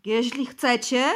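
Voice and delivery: loud, slow, blurted; belched out. Acted. Bosy on his knees blurts to the interrogators, loud. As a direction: blurt it out from the floor. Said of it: "beknął".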